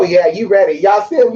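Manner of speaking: normal talking voice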